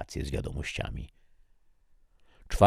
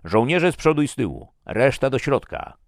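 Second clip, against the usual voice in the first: loud, high barked orders; commander's shout; 9 semitones higher, 8 dB louder than usual. A narrator's reading. Commander Svensson ordering the expedition to form columns, shouting. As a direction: bellow at the troops to move out. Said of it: "krzyknął Svensson".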